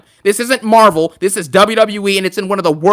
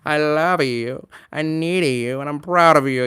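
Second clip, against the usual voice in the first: soft, low, earnest Cody Rhodes voice; hushed heartfelt Cody, slowed right down; Cody impression; 5 semitones lower, 6 dB quieter than usual. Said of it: "can you do a Cody impression".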